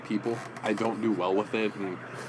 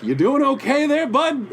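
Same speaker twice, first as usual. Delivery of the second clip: very high, louder acted check-in; pitched way up, quoting a concerned passerby; imitated friendly concern; 6 dB louder than usual. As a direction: imitate someone brightly checking on a stranger. Voices Stan checking on him.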